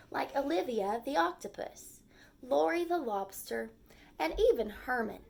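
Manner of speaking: gentle storybook read-aloud; lively story narration for young kids; reading to children. A narrator's reading. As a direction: read it like a picture book for the class. Teacher reads picture book aloud.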